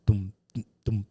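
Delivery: low voiced doom doom, like a bass guitar; bass line imitation. Shown as a demonstration. Host showing the reggae bass line, low booming doom sounds. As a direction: hum the bass notes low. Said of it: "the bass goes".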